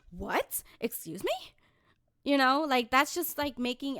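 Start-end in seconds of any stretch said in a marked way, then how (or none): 0.1-1.3 understated, offended questioning; restrained disbelief. Acted. Speaker told to calm down, restrained disbelief. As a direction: query it coolly, holding back. Retells the same reaction, subdued.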